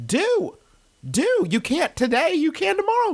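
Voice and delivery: high squeaky cheerleader voice; mock cheery urging. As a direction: chirp it way up like an eager coach.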